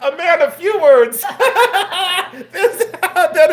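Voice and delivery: high, loud, talking through laughter; can barely get the words out for laughing; helpless laughter at the one-line reply. Real, not acted. Host cracking up at Bruce.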